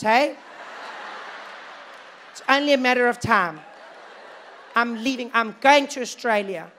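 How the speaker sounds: high, loud panicky voice. Retold or imitated. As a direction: fret about emigrating, loudly.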